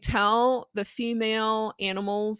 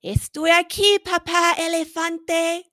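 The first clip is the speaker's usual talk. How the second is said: put-on higher, louder tiny baby voice; baby elephant calling to papa; high-pitched baby voice; 9 semitones higher, 8 dB louder than usual. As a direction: squeak it like a happy little baby. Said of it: "I did a high-pitched voice for the baby".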